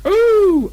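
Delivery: very high loud squeaky ooh; a little pig's yelp as he rises; high piglet ooh; squeal going up. Acted. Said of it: "he said".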